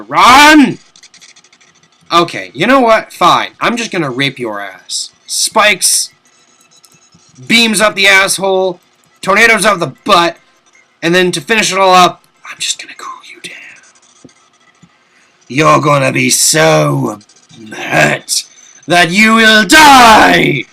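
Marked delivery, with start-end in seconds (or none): 0.0-0.8 loud burst. Real, not acted. Deciding to flee. 5.3-12.2 gleeful taunting. Real, not acted. Listing attacks on the boss. 12.5-13.5 whispered threat to the boss; menacing whisper. Pretend. Taunting the boss. 15.5-20.6 slow, drawn-out, building to a loud shout; hammy villain threat. Pretend. Taunting the boss.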